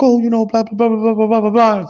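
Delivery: high-pitched blah-blah mimicry; mock woman's chatter. Woman explaining why she showed up at his door, high mocking chatter. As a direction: imitate her excuse in a high, monotone run.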